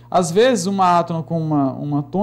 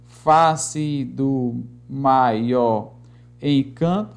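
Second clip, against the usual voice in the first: very slow, lower-pitched metrical reading; drawn-out verse scanning; syllable-by-syllable scansion; 3 semitones lower, much slower than usual. Shown as a demonstration. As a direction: stretch out each syllable evenly. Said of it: "vamos escandear aqui alguns versos".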